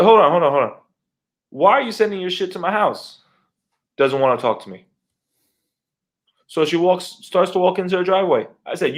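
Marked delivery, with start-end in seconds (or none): 0.0-3.2 calling after someone, pitched up; indignant challenge, higher and forceful; retold confrontation. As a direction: stop them and demand an answer. Calls after departing neighbor, confronting her.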